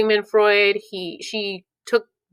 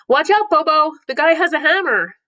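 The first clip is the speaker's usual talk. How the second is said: mock warning; 9 semitones higher, 4 dB louder than usual. Warning Bobo doll about hammer.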